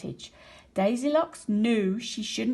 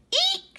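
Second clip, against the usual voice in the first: shrill startled eek, much higher; high shriek; 4 dB louder than usual. A narrator's reading. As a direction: shriek in sudden fright. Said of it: "she shrieked".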